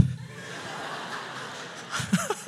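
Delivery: high unvoiced burst of laughter; real laugh. Real, not acted. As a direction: break into real airy laughter. Laughing at son's remark.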